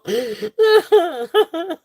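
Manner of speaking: high, helpless laughter; real laughing fit. Real, not acted. Seller cracking up with her live viewers, helpless laughing. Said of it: "I'm gonna be laughing about this day".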